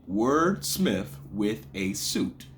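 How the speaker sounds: Wordsmith with a suit, slowly counted out; syllable by syllable. Shown as a demonstration. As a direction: separate beats through Wordsmith with a suit. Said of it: "Okay, that's five".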